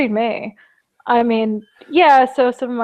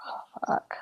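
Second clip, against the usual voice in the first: unvoiced shocked oh fuck; whispered shock; 16 dB quieter than usual. Real, not acted. Listener hearing of lost limbs, whispered shock. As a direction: breathe it out in horror. Reacting to casualties.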